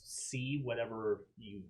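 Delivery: ordinary talk, nothing marked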